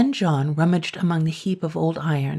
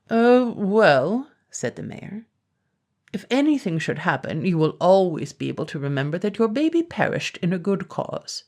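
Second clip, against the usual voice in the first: pompous mayor; 3 semitones higher than usual. Acted. Pompous mayor dismisses John.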